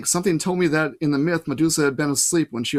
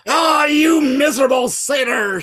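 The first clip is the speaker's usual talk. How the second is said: high, loud, slow furious roar; roar of rage; 11 semitones higher, 8 dB louder, slower than usual. A narrator's reading. Medusa roaring at Grover in rage. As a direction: roar it in fury. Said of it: "Medusa roared with rage".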